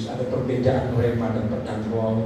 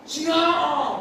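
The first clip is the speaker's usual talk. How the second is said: high, drawn-out call; forceful command; 13 semitones higher than usual. Retold or imitated. Preacher relaying the Father's word to a crowd, high forceful call. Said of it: "Bapak itu ngomong kencang sekali".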